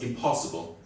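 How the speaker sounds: normal talking voice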